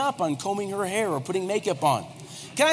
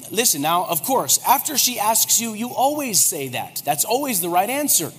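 ordinary talk, nothing marked

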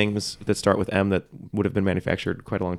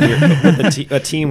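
laughing; 6 semitones higher, 7 dB louder than usual. Real, not acted. Laughs mid sentence.

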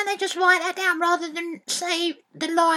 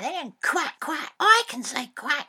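ordinary delivery

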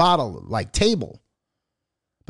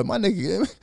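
usual speaking voice

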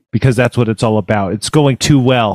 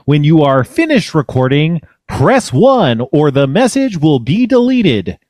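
slow, higher-pitched robotic phone-menu announcer; automated voicemail greeting; 4 semitones higher than usual. Acted. Host poses as voicemail for a caller, mock automated announcer. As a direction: recite it like a recorded voicemail system, swooping and measured.

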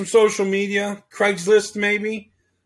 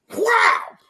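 high whap, a second crack; whip crack sound. Pretend. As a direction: snap it out again like a whip.

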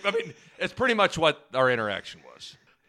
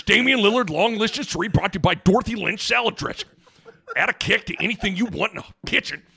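mock ad read; 4 semitones higher than usual.

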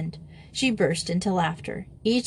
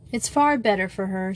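usual speaking voice

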